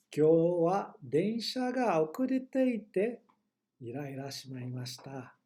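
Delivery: slow, clear read of the example; slow model sentence. Shown as a demonstration. Teacher slowly reads example to students. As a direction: spell it out slowly for learners.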